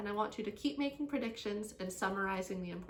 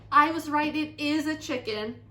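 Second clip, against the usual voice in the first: loud, higher, triumphant exclamation; exclaiming; 5 semitones higher, 12 dB louder than usual. A narrator's reading. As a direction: exclaim it as proof you were right. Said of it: "exclaimed Marilyn".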